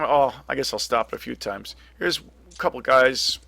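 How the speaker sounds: ordinary talk, nothing marked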